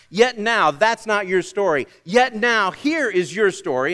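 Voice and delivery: proclaimed. Acted. Preacher proclaiming God's words.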